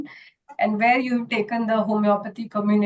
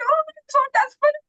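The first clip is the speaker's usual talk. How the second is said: much higher and fast, hammed-up desperation; desperate little wave; faster than usual. Little wave begging the old wave, high, fast, mock-desperate. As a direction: squeal it out like a frantic little wave. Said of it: "I'm like".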